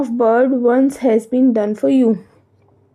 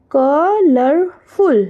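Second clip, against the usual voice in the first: colorful very slowly as "co... lor... ful"; split into syllables; 2 semitones higher, much slower than usual. Shown as a demonstration. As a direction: draw out each of the three beats in colorful. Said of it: "they have three syllable". (colorful को तीन हिस्सों में खींचकर, बहुत धीरे)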